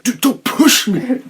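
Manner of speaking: high, loud, drawn-out mock yell; Eric's hissy fit. Imitating Eric before the team talk, mock hissy fit. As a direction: throw a hammed-up little tantrum. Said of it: "Eric has his little hissy fit".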